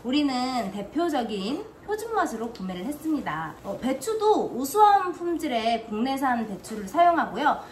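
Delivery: 차분한 제품 소개 낭독; 광고 읽듯 또박또박 설명하기; product review read. Acted. (시청자에게 김치 제품을 소개하는 리뷰 내레이션)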